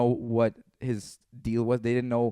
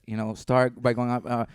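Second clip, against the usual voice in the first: ordinary delivery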